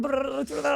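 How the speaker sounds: rapid rolled brrr noise; speed-talk trill. Shown as a demonstration. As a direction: imitate racing through words with a trill. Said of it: "talk like that".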